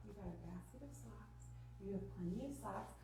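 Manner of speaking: soft, matter-of-fact logic; reasoning parent. Acted. Parent answering upset child with left-brain logic, dry. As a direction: reason quietly, unmoved by the fuss. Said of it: "matching left brain to right brain".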